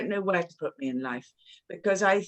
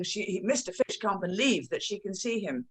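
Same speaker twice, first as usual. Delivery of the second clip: ordinary delivery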